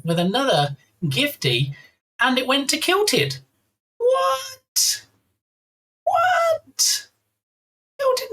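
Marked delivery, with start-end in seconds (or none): 4.0-5.0 very high squeak of disbelief; shocked. Real, not acted. Shocked at surprise gift. 6.0-8.3 shocked. Real, not acted. Shocked at surprise gift.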